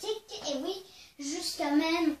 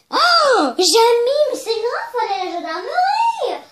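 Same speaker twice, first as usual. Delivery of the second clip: alarmed; 8 semitones higher, 13 dB louder than usual. Acted. Friend alarmed by Jamie's wound.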